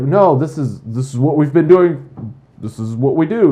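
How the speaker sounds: mock indignant old-timer; defensive insistence. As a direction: dig in, this is how it's always done. Insiders defending their old ways.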